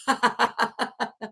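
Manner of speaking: soft helpless laughter; laughing fit. Real, not acted. Reader collapses into laughter after the scare. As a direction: laugh it off, can't stop.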